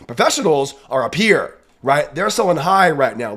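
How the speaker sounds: usual speaking voice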